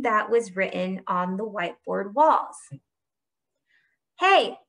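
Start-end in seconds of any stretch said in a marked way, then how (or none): 4.2-4.6 high, loud call; sharp raised shout. A narrator's reading. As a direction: shout it out to catch someone. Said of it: "shouted Akemi".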